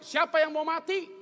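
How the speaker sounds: high, loud protest; mock indignant retort. Husband nudging his praying wife, mock indignant. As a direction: cut in with an offended question. Husband interrupts wife's deathbed prayer.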